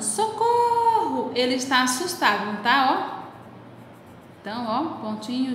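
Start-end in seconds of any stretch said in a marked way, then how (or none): 0.0-1.3 high, slow, drawn-out call for help; cry for help. Shown as a demonstration. A scared boy calling for help. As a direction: call Socorro as if in danger. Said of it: "o rapaz tá gritando".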